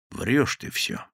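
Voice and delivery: whispered accusation; cold whisper. Acted. Gang boss quietly accuses the thief of lying. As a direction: breathe it out coldly. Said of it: "сказал Василий Иванович".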